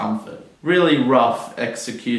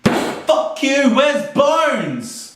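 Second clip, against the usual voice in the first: much higher hammed-up Scottish voice; mock Scottish accent; much slower than usual. Reviewer heckling the doctor, hammed-up mock Scottish.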